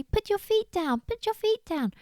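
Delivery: high, insistent squirrel call. Acted. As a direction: urge a struggling friend from the bank. Squirrel calls out instructions.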